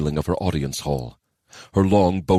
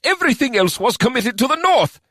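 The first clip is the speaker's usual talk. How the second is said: vehement; 4 dB louder than usual. Acted. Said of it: "with such vehemence".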